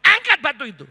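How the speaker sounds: loud, firm command; commanding Jesus. Retold or imitated. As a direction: order the stone lifted with authority. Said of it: "Iya, Yesus yang ngomong". (perintah Yesus yang tegas dan lantang)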